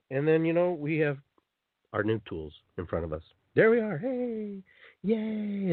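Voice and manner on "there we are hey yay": a small pretend celebration; mock cheer. Mock cheer with new tools.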